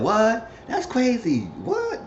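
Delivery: very high, incredulous little outbursts; mock invested listener. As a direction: imitate someone gobsmacked by gossip.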